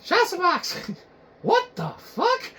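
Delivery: high, slow disbelieving whine; a viewer scolding the host in a high voice. Acted. Viewer scolding Shiza Box in a high slow whine. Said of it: "It's like".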